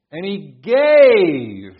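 "gave" very loud, high and held long; stress on "gave". Shown as a demonstration. Preacher showing emphasis on the verse, stress on "gave". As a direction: belt out a drawn-out "gave". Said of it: "Anywhere you put the emphasis".

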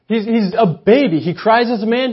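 ordinary talk

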